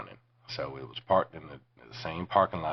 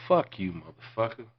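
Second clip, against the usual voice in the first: loud, raised retold insult; acted angry dismissal; angry rebuff; 5 semitones higher, 7 dB louder than usual. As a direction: throw the insult out hard.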